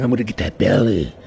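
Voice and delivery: teasing cat voice. Retold or imitated. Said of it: "I do this".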